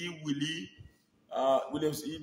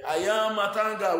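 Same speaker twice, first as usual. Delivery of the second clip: mimicking the woman; 6 semitones higher, 7 dB louder than usual. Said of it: "that woman will say".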